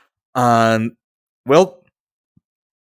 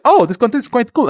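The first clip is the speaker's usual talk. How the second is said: lightly acted impressed reaction, higher and louder; voicing Twitter; 9 semitones higher, 5 dB louder than usual. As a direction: play an impressed platform discovering a post. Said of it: "Twitter is going to be like".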